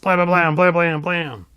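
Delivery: mock blam blam firing; gun blasts. Sorceress blasting Skeletor, mock gunfire.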